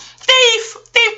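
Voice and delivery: high, loud repeated Thief; Felix yelling. Retold or imitated. Felix yells at Oscar over gambled money, loud high shout. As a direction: shriek it in Oscar's face. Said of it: "Felix started yelling in his face".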